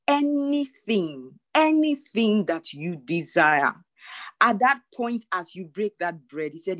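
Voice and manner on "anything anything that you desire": drawn out slowly, weighty; slow, solemn voice of God; solemn slow quote. Retold or imitated. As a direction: let each word hang, slowly. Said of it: "Spirit of God said".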